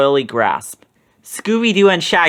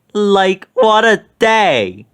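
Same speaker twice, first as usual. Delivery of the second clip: higher, louder and slow; Shaggy exclaiming; 5 semitones higher, 5 dB louder, much slower than usual. A narrator's reading. Shaggy complaining to Scooby, higher slow exclamation. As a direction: stretch it out in weary disbelief. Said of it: "Shaggy exclaimed".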